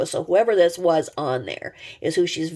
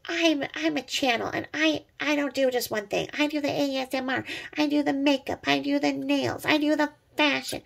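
high, smug mock voice; higher mock bragging; mocking the YouTuber; 6 semitones higher than usual. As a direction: parody her bragging. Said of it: "So then she's like".

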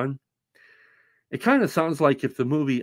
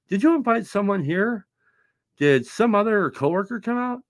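lightly voiced homeowner; 10 semitones higher than usual. Homeowner quoted in story.

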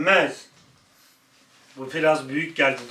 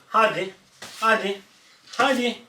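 high, louder coaxing; urging the dog; 7 semitones higher, 4 dB louder than usual. Real, not acted. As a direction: coax the dog along, high.

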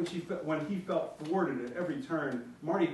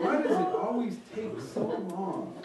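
put-on whiny child; 5 semitones higher, 3 dB louder, slower than usual. A narrator's reading. Young son demanding.

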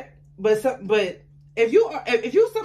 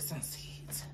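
whisper; 6 semitones lower, 21 dB quieter than usual. Real, not acted. Drops voice to a near-whisper.

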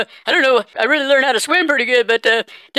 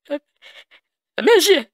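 hammed-up tearful plea, breaking down; choked up; 7 semitones higher, slower than usual. Caller pleading to his estranged wife on air, crying. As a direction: voice cracking high and breaking into sobs. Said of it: "I'm getting a little choked up here".

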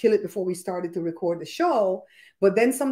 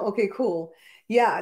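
normal talking voice

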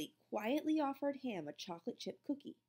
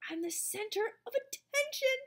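proud thought; 13 semitones higher than usual. A narrator's reading. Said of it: "he thought".

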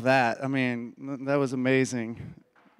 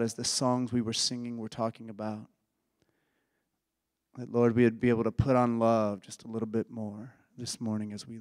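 prayer. Acted. Pastor leads a prayer.

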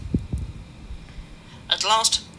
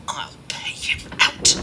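muttering to himself; slower than usual. A narrator's reading. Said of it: "Diesel said to himself".

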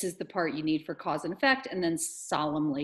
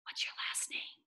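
hushed, breath-only question; whispered; 10 dB quieter than usual. Acted. Amanda privately asks Jeffrey his surname, whispered. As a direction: whisper it aside so nobody hears. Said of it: "She whispered".

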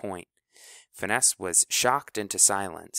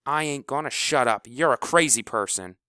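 loud, high defiant retort; 4 semitones higher, 5 dB louder than usual. Acted. Kleebo defies House, loud and angry. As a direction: throw it back at him.

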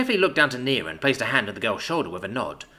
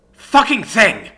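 much higher and louder furious shout; angry yell; 10 semitones higher, 10 dB louder, much slower than usual. Acted. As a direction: bellow it at the jammed gun. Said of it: "Jack yelled".

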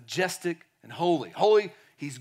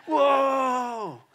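higher and louder, drawn-out awe; overwhelmed by God's presence; reverent whoa; 8 semitones higher, 5 dB louder than usual. Retold or imitated. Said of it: "but I mean, like".

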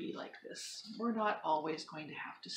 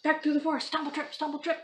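high, loud hurried panic; frantic; 9 semitones higher, 16 dB louder than usual. Acted. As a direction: rush it in a panic. Frantic run home from bear.

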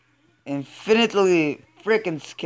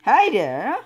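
ordinary delivery

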